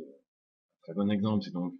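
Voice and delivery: ordinary talk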